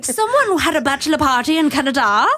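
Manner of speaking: mock Canadian accent. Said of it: "That's a Canadian accent".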